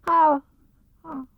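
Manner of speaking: high, small nervous Piglet squeak. Acted. Piglet to himself on waking, small nervous squeak. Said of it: "he said to himself".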